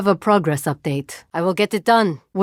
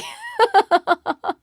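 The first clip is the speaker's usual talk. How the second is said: laugh. Acted. Laughs after a joke.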